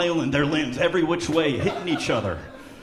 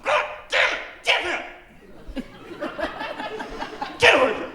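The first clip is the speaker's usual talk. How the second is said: snapping teacher; faster than usual. Retold or imitated.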